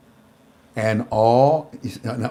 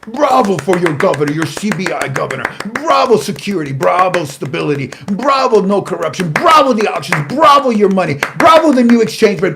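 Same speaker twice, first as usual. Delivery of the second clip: loud, high triumphant cheering of bravo; 6 semitones higher, 7 dB louder than usual. Retold or imitated. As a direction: hail them like a proud official. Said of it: "how they're saying to the Iraqi citizens".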